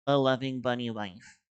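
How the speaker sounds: smug mock of "a loving one". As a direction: throw the words back, teasing. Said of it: "I hate to say I told you so".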